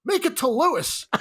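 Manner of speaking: high, cocky frat bro request; frat boy. Retold or imitated. Frat boy asking the lawyer for an autograph, high cocky. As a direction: hand over the Sharpie with a grin. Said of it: "I take a Sharpie that I had and I go".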